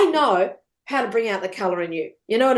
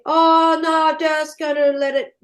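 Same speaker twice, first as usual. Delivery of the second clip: higher, louder mock host voice; imitating podcast host; 5 semitones higher, 4 dB louder than usual. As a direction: lightly mimic her airy dismissal.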